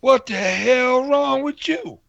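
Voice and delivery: slow, high shocked outburst. Real, not acted. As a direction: draw it out, appalled. Disgusted outburst at bathroom story.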